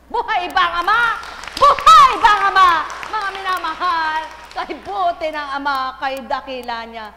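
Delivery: high, loud fervent cry; preaching shout. Acted. Preacher crying out to the congregation at full force. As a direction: shout the question to lift the hall.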